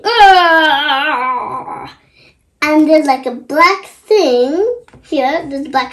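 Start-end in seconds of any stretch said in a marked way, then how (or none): 0.0-1.9 long drawn-out mock growl; grumpy growl. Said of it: "He's saying".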